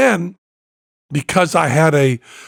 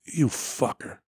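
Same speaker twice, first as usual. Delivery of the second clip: muted curse; 4 semitones lower, 11 dB quieter, slower than usual. Retold or imitated. Tony after long pause.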